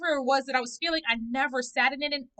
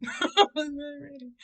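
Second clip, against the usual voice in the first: laughing. Real, not acted.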